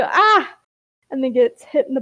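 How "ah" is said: short high yelp of pain; acted sudden "ah"; startled yelp. A narrator's reading. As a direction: cry out as if hit mid-sentence. Ember struck from behind mid-sentence.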